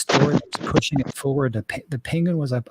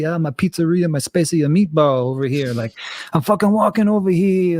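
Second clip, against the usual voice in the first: louder, exaggerated New York Italian-American; mock East Coast New York accent; 3 semitones higher, 4 dB louder than usual. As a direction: ham up a New York wise-guy accent. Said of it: "he's not doing an Italian accent".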